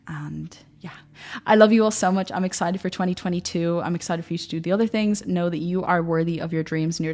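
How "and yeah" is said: whispered aside. Real, not acted. Trailing soft aside.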